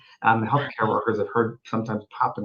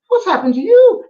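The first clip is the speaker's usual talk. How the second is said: Scottish woman; 8 dB louder than usual. Retold or imitated. Medium retelling a Scottish spirit, high Scottish lady voice. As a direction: play the old Scottish woman, bemused. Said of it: "it was a Scottish woman".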